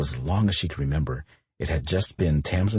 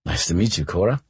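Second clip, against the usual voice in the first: usual speaking voice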